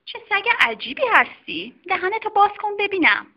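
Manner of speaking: a brisk doctor examining a patient; doctor's voice. Acted. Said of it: "خانم دکتر گفت".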